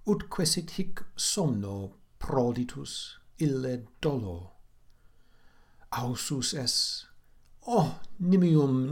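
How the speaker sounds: slow, dramatic reading of Latin verse; measured Latin verse recital; poem recitation. Acted. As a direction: declaim it like a lament.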